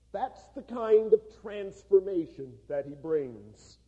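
a preacher drawing the moral for his congregation, booming; preaching at full force. Acted. Preaching the point of the joke.

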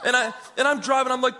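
plain reading voice

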